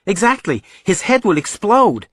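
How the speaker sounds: put-on high, loud, emphatic young son; exasperated boy. Son proving his point to mom, exasperated. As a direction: hit back loudly in a high voice. Boy's heated argument with mother.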